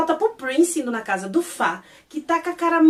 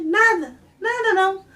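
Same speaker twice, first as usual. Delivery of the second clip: dismissive cover-up; 8 semitones higher, 4 dB louder than usual. Retold or imitated. Acted hasty cover-up.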